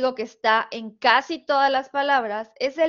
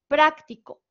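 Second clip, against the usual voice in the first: "práctico" with PRÁC punched louder and higher; stress on first syllable; 4 semitones higher, 6 dB louder than usual. Shown as a demonstration. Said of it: "una sílaba que ustedes pronuncian con una entonación diferente".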